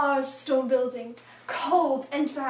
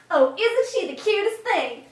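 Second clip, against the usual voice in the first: put-on higher, louder cooing over a child; gushing admirer; 6 semitones higher, 7 dB louder than usual. Churchgoers admiring a little girl, gushing.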